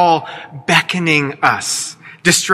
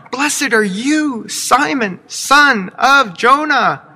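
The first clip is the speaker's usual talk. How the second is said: higher, slow proclamation of blessing; exclamation mark; 7 semitones higher, slower than usual. Acted. Jesus blesses Simon, slow and high with an exclamation mark. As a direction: declare it with emphatic joy. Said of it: "with an exclamation mark".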